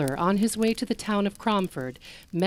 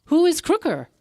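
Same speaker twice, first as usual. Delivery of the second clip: a higher, louder call after her; 9 semitones higher, 6 dB louder than usual. Acted. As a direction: call out to the vanished woman. Traveler calls after woman.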